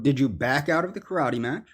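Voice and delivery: usual speaking voice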